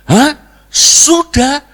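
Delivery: high, loud incredulous question; mock outraged disbelief. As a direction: throw their answer back, shocked. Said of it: "Abu Nawas gebrak meja". (kaget dibuat-buat, tinggi dan keras)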